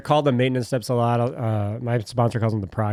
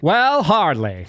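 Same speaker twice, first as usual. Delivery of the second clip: infomercial; 6 semitones higher, 6 dB louder, slower than usual. Shown as a demonstration. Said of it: "You gotta say it like a, like a infomercial".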